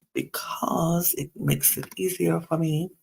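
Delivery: unvoiced whispered aside to the viewers. Real, not acted. A vlogger whispers an aside to viewers, hushed. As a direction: drop to a whisper for a quick aside.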